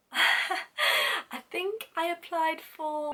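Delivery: high, drawn-out hesitant guess; unsure answer. Retold or imitated. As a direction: stall nervously, stretching each word upward. Acts out unsure answer to interviewer.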